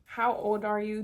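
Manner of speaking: ordinary talk, nothing marked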